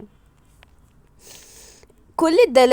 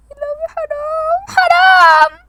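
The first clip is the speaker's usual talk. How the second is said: high wailing cry, rising to louder; 7 dB louder, slower than usual. Real, not acted. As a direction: wail in real distress. Distress at the photos.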